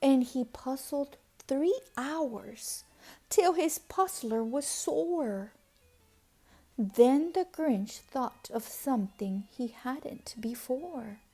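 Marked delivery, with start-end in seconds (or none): none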